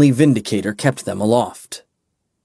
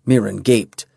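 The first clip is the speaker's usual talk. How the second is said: usual speaking voice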